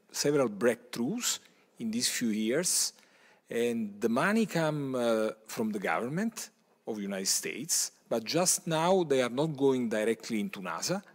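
ordinary talk

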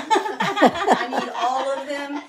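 slow, laughing through the words. Retold or imitated. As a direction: laugh it out slowly. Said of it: "I was like".

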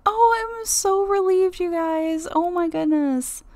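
relieved owner telling viewers the cat is back. Real, not acted. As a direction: let the relief pour out.

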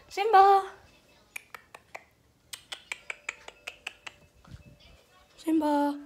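loud high call for the cat. Real, not acted. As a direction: shout the cat's name. Calling for the cat.

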